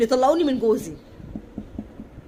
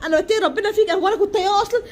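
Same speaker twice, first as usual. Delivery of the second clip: high, tearful pleading, voice pushed up and louder; crying while pleading; 7 semitones higher, 3 dB louder than usual. Retold or imitated. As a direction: beg through tears, half laughing as she retells it. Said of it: "وانا عماله اعيط واقول له". (بكاء وتوسل بصوت عالي)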